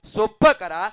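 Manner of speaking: loud, forceful kirtan preaching; जोशपूर्ण प्रवचन. Real, not acted. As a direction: drive the appeal home to the congregation, loudly. (जोरदार आवाजात कीर्तनकाराचं प्रवचन)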